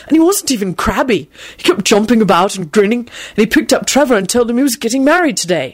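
lightly amazed, animated telling; eager astonished boyish account. A narrator's reading. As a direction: report it in wonder, voice lifting. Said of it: "he told them in an amazed voice".

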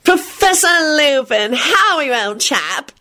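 high, drawn-out hearty greeting; pompous greeting. A narrator's reading. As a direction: greet like an officious old-school chap. Pompous Percy greets Lupin.

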